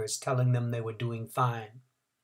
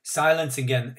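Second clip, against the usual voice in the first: plain reading voice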